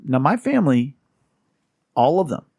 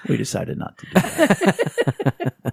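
normal talking voice

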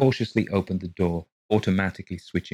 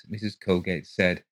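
plain reading voice

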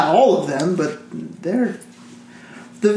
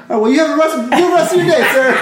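suddenly polite; 9 semitones higher, faster than usual. Retold or imitated.

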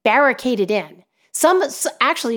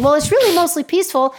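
mocking reporter; 7 semitones higher, faster than usual.

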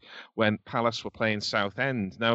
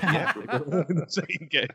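retelling it through laughter, pitched up; amused retold reaction; 5 semitones higher than usual. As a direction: laugh as you echo the boy's reaction. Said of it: "I think his reaction was".

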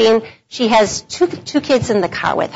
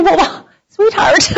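tender, laughing; 8 semitones higher, slower than usual. Retold or imitated. To the sobbing driver after a crash, tender laughing comfort. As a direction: comfort her with a chuckle.

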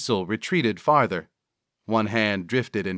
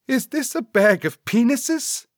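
high-pitched sidekick disbelief; appalled; 14 semitones higher, 3 dB louder than usual. Acted. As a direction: ask in a high, horrified voice. Cecil horrified by bag.

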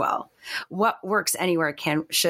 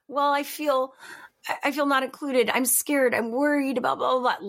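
put-on a lightly raised, small scared child's voice; inner child voice; 7 semitones higher than usual. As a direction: let the frightened inner kid speak, higher. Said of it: "baby [name] voice".

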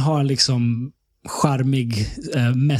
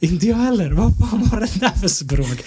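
baffled; 6 semitones higher than usual. Retold or imitated. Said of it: "friend's confused reply retold".

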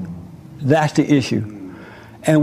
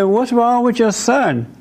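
high, prying question; nosy church people; 7 semitones higher than usual. Retold or imitated. Church people ask mother about her son, high and prying. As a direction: church folk judging the mother, pitched up.

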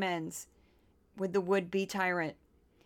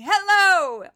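very high, loud piercing hello; glass-shattering hello; 12 semitones higher, 11 dB louder than usual. Retold or imitated. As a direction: hit a note to shatter the china. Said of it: "destroy an entire chest of china with her voice alone, just by saying".

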